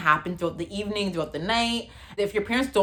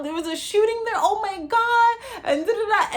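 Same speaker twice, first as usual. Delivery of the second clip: mom going off; 14 semitones higher than usual. Retold or imitated. Mom frets about the mall shooting, high panicked mom voice. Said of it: "my mom starts going off".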